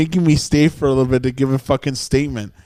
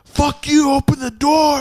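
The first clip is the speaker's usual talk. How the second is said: pissed-off yelling; 11 semitones higher than usual. Retold or imitated. Storyteller imitates the guy at the locked door, high furious yell. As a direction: act out a furious man demanding to be let in. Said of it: "super pissed off".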